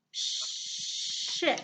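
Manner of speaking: "ship" with a long hissed sh at the start; stretched sh sound. Shown as a demonstration. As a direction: lean on the sh of "ship". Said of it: "make the S H sound like".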